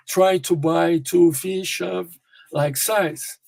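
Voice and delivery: slow, each word separate: "Try to buy two fish of like size"; careful word by word. Shown as a demonstration. Teacher models the sentence word by word for students. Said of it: "No connected speech".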